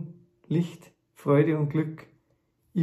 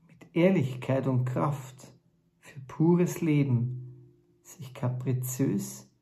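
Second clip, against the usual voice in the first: measured spoken-word recital with long pauses between phrases; poem recitation; 3 semitones higher, 4 dB louder than usual. Acted. Author performing his text about truth and peace, poem recitation.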